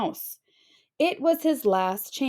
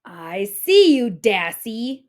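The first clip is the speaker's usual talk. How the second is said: mild loud eagle call; a lightly voiced screech from above; 7 dB louder, slower than usual. A narrator's reading. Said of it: "the eagle screeched".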